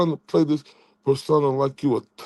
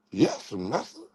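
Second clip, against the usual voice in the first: lightly voiced, softer meek reply; mock servant; 6 semitones lower, 6 dB quieter than usual. Streamer playing his rival as an obedient pet, quietly. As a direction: mumble it like a cowed pet.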